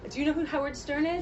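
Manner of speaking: normal talking voice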